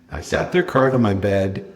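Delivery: ordinary talk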